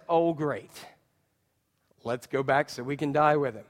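low, deadpan sarcastic resignation; sarcasm. Acted. Thomas to the other disciples, dry sarcasm. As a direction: grumble it drily, low and quiet. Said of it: "you hear the sarcasm in that".

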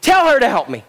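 losing it. Acted. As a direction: rant like a fed-up sibling telling on her. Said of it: "She has lost it".

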